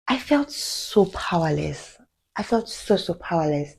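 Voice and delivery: soft, slowed admission of powerlessness; helpless. Real, not acted. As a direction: let the voice sink quietly as you confess it. Recalls her helplessness in the attack.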